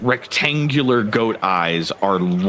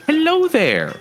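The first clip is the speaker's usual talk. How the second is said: high, lilting greeting through a smile; bright smiling satyr. Acted. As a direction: greet a stranger brightly, pitched way up. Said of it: "with a bright smile, and they say".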